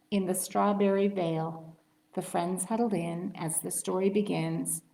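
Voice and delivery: ordinary delivery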